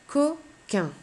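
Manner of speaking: "Coquin" in two beats, high Co, pause, quin; split into syllables. Shown as a demonstration. Teacher presents next word to pupil, "Coquin" split in two syllables. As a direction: separate "Coquin" after Co with a clear gap. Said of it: "j'entends deux syllabes".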